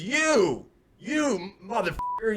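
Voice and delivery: high, drawn-out mock holler; retold angry yell. Storyteller as stranger singling him out, mock angry yell. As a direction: imitate a man yelling an accusation. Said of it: "he yells at me".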